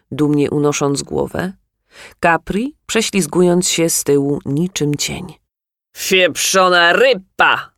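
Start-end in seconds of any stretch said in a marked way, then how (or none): 6.0-7.7 put-on drunken slurred shout; slurred drunk yell. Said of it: "wrzasnął ktoś zabitym głosem".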